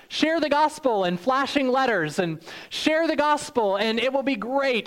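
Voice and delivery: big salesman pitch, all upside; mock sales pitch; hype pitch. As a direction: sell it like a flashy ad. Mock hype fine print ad.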